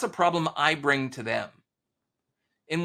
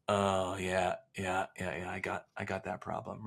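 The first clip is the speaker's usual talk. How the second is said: customer conceding quietly; 7 semitones lower, 8 dB quieter than usual. Retold or imitated. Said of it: "And you're like".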